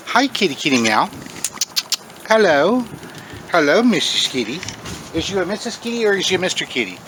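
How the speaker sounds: coaxing the cat to come eat. Real, not acted. Baby talk to the cat.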